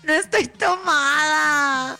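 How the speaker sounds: slow whiny tipsy denial; hammed-up tipsy protest; mock drunk denial. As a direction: deny being drunk with a playful slurred whine. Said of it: "¿Cómo te dejaron ir tomada".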